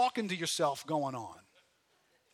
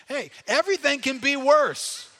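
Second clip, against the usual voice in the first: loud punchline; 7 semitones higher, 10 dB louder than usual. Acted. Punchline of his point.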